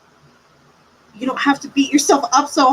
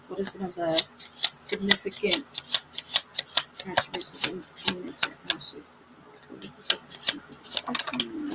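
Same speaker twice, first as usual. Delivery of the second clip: whispering; 4 semitones lower, 8 dB quieter than usual. Real, not acted. Asks the spirits for Ash.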